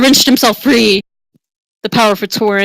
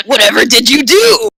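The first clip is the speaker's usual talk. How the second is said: lightly voiced muscular lady, less squeaky; brawny woman's voice; 4 semitones higher, 4 dB louder than usual. Tauren woman gasps at the mustached hero, lightly brawny. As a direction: redo it tougher, still a bit high. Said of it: "muscular tone to it".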